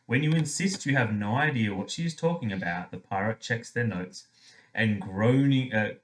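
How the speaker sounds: ordinary talk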